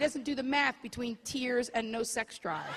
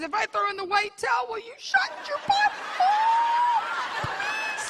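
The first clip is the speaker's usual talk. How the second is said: higher, louder begging for it to stop; pleading man; 3 dB louder, slower than usual. Retold or imitated. The man to the woman, hammed-up whiny plea to end it. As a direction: beg for peace, whiny and exasperated. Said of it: "man speak for".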